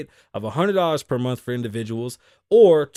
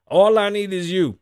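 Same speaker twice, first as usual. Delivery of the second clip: higher and louder; mock fan yell; 6 semitones higher, 7 dB louder than usual. Man calls to Mike Tyson, higher louder mock call. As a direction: call it out to your idol.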